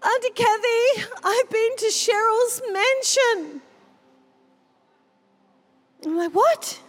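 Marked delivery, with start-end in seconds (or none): 0.0-3.6 put-on child's voice. Little girl telling Auntie Kathy about the mansion, high and awed. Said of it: "She's like". 6.3-6.8 very high stunned "What?"; astonished. Retold or imitated. Hearing the girl's vision, high astonished what.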